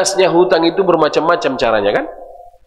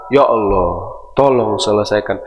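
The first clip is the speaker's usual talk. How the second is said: hushed prayer; 12 semitones lower than usual. Acted. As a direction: murmur it as a humble prayer. Quoted prayer to Allah.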